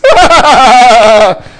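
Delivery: a loud, real burst of laughter; hearty laugh. Real, not acted. Laughing at the big crowd.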